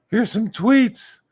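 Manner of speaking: slow, high falsetto mockery; drawn-out squeaky caricature; Nicholas. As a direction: announce it in a silly high voice. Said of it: "he sounds like that".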